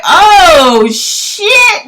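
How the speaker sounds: alarmed oh shit. Retold or imitated. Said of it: "I was like".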